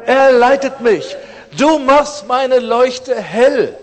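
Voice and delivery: loud, slow, high proclamation of scripture; preaching at full force, drawn out; proclaiming loudly. Acted. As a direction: cry the verse out to the room, slowly. Preacher proclaims a psalm verse.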